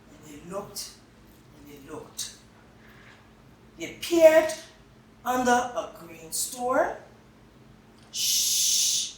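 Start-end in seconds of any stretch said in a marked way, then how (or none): 0.1-2.3 softer and softer storytelling; fading repetition. A narrator's reading. Repeated searching, fading. 8.1-9.1 whispered shush; hushing. Acted. Hushing to hear mice.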